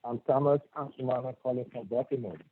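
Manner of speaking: very soft and low, hard to hear; barely audible. Real, not acted. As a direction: speak far from the mic, barely audible. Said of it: "Your voice is very low".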